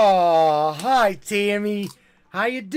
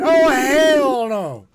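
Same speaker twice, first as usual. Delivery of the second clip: drawn-out mock refusal; 6 semitones higher, 6 dB louder, much slower than usual. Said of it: "And Morgan saying".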